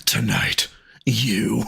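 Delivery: low menacing whisper; creepy whisper. Retold or imitated. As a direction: whisper it like a stalker. Creepy caller threat.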